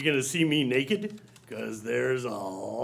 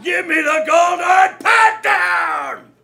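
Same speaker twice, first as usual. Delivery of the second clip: shouting demand; 9 dB louder than usual. Acted.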